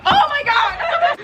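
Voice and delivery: high, slow, laughing shriek of disbelief; laughing disbelief, high and drawn out; shocked oh my God. Retold or imitated. Shocked reaction in story.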